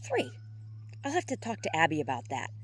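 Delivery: lightly acted nervous reply. A narrator's reading. Chaz dodging Johann's question about missed calls, mildly nervous. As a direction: play it a touch uneasy. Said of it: "Chaz chuckled nervously".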